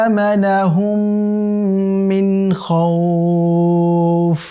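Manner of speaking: slow, drawn-out melodic tilawat; Quran recitation. Shown as a demonstration. Teacher recites the verse for students in slow melodic chant. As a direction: chant the verse slowly with long held vowels. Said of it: "সর্বশেষ আয়াত".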